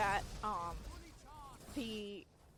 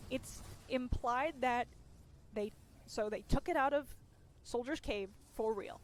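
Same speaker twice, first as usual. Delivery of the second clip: normal talking voice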